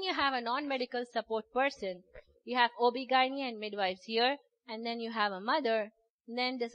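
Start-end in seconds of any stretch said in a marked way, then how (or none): none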